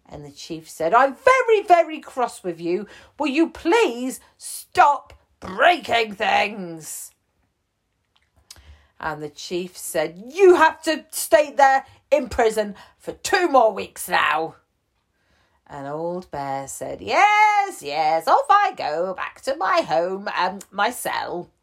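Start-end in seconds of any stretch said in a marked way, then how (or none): 0.9-7.1 high, loud mock scolding; very, very jumps up high in mock anger; cross chief. 10.3-14.6 high, loud mock stern sentence; mock strict jailer; stern chief. 17.0-21.5 yes jumps high, loud; a cheerful bear trotting back to his cell; old bear voice. Acted.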